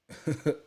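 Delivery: quick burst of laughter; high, loud little laugh; short real laugh. Real, not acted. Laughing at his own Sharpton story.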